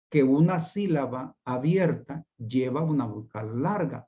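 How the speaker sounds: normal talking voice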